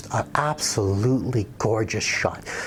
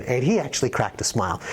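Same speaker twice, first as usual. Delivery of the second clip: ordinary talk, nothing marked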